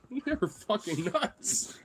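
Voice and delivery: high, slow words through laughter; real amused disbelief, laughing. Real, not acted. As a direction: say it while cracking up. Laughing at the dogs' wild play.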